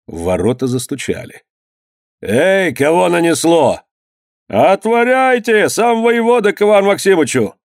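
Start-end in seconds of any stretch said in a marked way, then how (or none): 2.2-3.8 raised-pitch challenge to the gate; calling out. A narrator's reading. Master hailing arrivals outside the gate, calling out. 4.5-7.6 calling out. A narrator's reading. Servant announcing the governor at the gate, calling out. Herald shouting at the closed gate.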